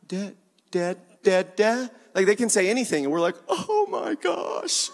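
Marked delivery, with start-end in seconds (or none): 0.1-1.9 put-on babbling baby. Imitates baby's first words. 3.5-4.9 high, drawn-out squeal of delight; gushing parents. Acted. Parents to babbling baby, high delighted gushing.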